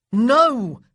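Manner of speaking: loud, emphatic shout; howled no. A narrator's reading. Big brother howls no at his sister. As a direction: refuse with a near howl. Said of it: "Daniel practically howled".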